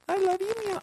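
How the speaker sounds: squeaky high cat. Pretend. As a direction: purr it up like an adoring cat. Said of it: "I'll try it for Aaron".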